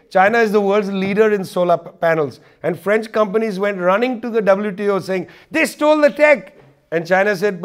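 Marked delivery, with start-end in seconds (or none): 5.5-6.6 mock complaint. French companies complain to WTO.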